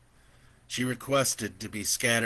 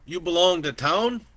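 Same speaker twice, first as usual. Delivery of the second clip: put-on higher, louder, friendly old rancher; an old man chatting easily from horseback; gentle old landowner welcoming a stranger; kindly old man; 8 semitones higher, 9 dB louder than usual.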